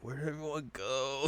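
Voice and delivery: soft, put-on forlorn question; lost little voice. Shippo waking after everyone left, soft mock forlorn. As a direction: ask quietly, playing abandoned. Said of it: "he's like".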